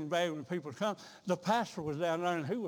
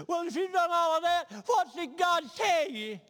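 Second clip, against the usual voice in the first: put-on old pastor; 11 semitones higher, 4 dB louder than usual. Preacher questions the crying girl.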